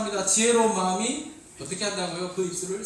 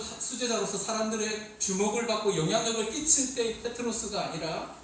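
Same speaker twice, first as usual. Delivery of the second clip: normal talking voice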